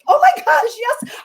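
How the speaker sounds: high, thrilled re-enacted yes; so excited. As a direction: gush it high, like an eager volunteer. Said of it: "I was so excited".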